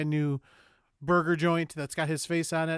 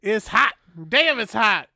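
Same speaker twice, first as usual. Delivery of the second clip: mock complaint about heat; 6 semitones higher, 10 dB louder, slower than usual. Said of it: "He goes".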